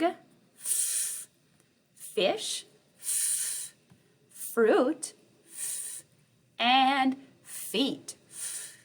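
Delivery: a voiceless "f" hiss before each word; hissed f sounds between words. Shown as a demonstration. Teacher drilling the f sound with frog, fish, fruit and feet. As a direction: alternate a breathy "f" with each f word. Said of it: "that letter F sound".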